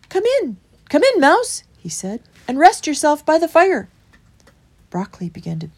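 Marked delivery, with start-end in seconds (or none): 0.1-1.6 high, loud, quick greeting; welcoming badger. A narrator's reading. Badger inviting Christopher in, high and brisk. 2.5-3.9 high, loud character voice; welcoming badger. A narrator's reading. Badger welcoming Christopher to the fire, high and loud.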